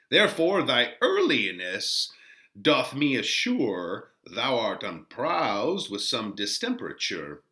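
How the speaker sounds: measured, slow verse as the gentle friar; old friar. Acted. Teacher voicing the friar greeting early-rising Romeo, lightly voiced. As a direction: speak as a kindly friar teasing his young charge.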